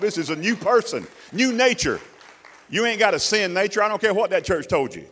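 loud, rising sermon voice; preaching at full force. Acted. Preacher pressing the congregation, loud and climbing. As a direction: bring it up to a full preaching roar.

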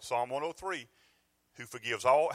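normal talking voice

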